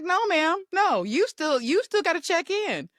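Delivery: high, scolding parent tone to mom; playful strict scolding; stern daughter. Retold or imitated. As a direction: lay down the rules like a parent. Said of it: "I was like".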